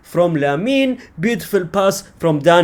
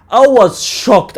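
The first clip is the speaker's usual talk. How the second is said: higher, louder and slow; mock shock; 6 semitones higher, 5 dB louder, slower than usual. Real, not acted. Fan recalling halftime score, drawn-out shock. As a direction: stretch out the shock.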